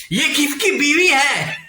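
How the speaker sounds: high, drawn-out comic shout; mock viewers' outcry. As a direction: shout the question in mock astonishment. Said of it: "वो लोग ये सोच रहे होंगे". (मज़ाकिया हैरानी में ऊँची, खींची चीख)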